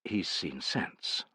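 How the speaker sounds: soft and slow, to oneself; hushed, drawn-out aside; inner thought. A narrator's reading. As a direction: think it privately, well under full voice. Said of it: "thought Tullus".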